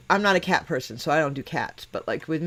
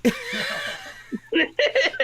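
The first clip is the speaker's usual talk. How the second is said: high laugh; 4 dB quieter than usual. Real, not acted. Laughing at a friend's dog theory, real high laugh.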